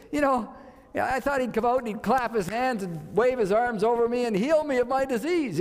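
mad. Retold or imitated. Naaman complains about the prophet's cure, mad. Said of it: "He was mad".

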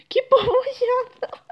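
high, loud, breaking into a laugh; can't keep a straight face saying it; squealed through a giggle. Shown as a demonstration. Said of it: "Fala bem assim".

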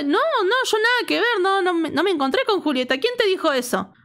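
high-pitched imitated protest; retold defensive denial. Ileana denies meeting Julieta to Pochi, defensive protest. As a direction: play the caught friend denying everything. Acts friend denying the accusation.

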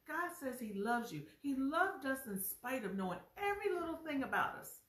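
ordinary talk

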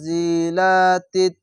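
উনজিলাতিত slowly, tight doubled consonant; slow recitation. Shown as a demonstration. Teacher shows the joined reading, slow recitation. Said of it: "তা তাজের তিত বলে মিলিয়ে পড়বেন".

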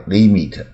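"limit" as "lee-mee-t" with long ee; tense ee in both syllables of "limit"; local learners' pronunciation. Shown as a demonstration. Teacher mimicking how we say it, "lee-meet" for "limit". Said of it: "我们一定念".

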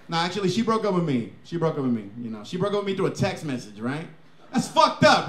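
ordinary talk, nothing marked

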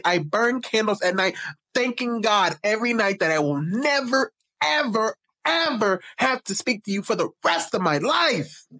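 high, urgent mock plea; hammed-up desperate begging; dramatic pleading. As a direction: beg at length as the frightened woman, building to "ever, ever". Voices her desperate plea to creep.

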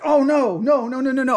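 a retold, half-acted wail of dismay; panicked cry. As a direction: act out the panic of ruined pants.

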